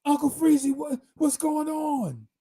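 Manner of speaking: mock boisterous shout; big friend's loud greeting. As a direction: holler it through the door like a huge excited buddy. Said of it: "This is how he always do my door".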